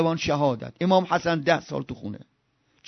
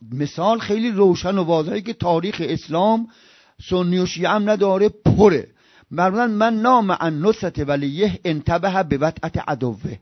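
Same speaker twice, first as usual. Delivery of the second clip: ordinary talk, nothing marked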